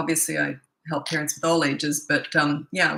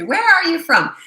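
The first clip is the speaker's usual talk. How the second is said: higher, louder cheery question; bright greeting; 11 semitones higher, 9 dB louder than usual. Retold or imitated. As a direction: call it out to the viewers. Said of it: "I was just gonna say".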